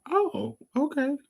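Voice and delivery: high, slow, airy oh okay; a sweet put-on high oh okay; high mock okay. Her reply to the boy.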